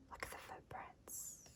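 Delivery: hushed whisper over a clue. Acted. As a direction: breathe it like a secret find. Whispered discovery in story.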